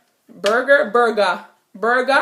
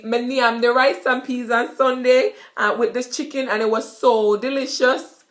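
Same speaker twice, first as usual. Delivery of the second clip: Jamaican patois; 3 semitones higher than usual. Shown as a demonstration. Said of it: "So basically".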